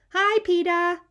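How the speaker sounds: put-on high, bright toddler greeting; childlike hello; little brother voice. A narrator's reading. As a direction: chirp it like a small kid.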